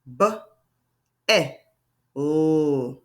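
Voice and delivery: B, eh, oh as three separate sounds, long L for "oh"; word tapped out. Shown as a demonstration. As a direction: sound out "B, eh, oh" with clear gaps. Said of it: "Let's try that again".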